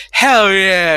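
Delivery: crowd shout. Retold or imitated. Crowd cheering answer.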